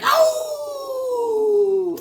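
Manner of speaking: high startled yelp; mock startled cry. Werewolf with glowing eyes, a high startled yelp. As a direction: jump at it with a sudden high oh. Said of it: "He's like".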